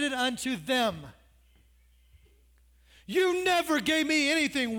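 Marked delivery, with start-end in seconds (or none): 3.1-4.8 higher, louder sulky accusation; older son snapping at his father; indignant older son. Acted. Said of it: "older son complaining".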